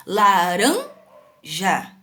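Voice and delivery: laranja slowed, with "ran" stretched and pushed; stress on RAN. Shown as a demonstration. Teacher quizzing pupils on the tonic syllable, exaggerated stress. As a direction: break up "laranja", leaning hard on the middle syllable ran. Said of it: "qual que eu pronunciei mais forte?".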